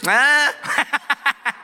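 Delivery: high burst of laughter trailing into chuckles. Real, not acted. Preacher laughing at his own accent slip, helpless laugh. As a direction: crack up at your own slip.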